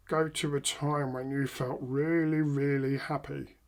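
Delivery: slow, low, subdued delivery; unenthused wrong way. Shown as a demonstration. Trainer showing what won't get a client there, half-hearted. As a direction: say it softly and slowly, no energy put in. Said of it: "yeah, they're not gonna get that".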